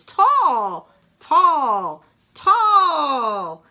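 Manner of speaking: stretching up. Acted. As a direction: stretch the voice up like reaching for the sky. Stretching up tall.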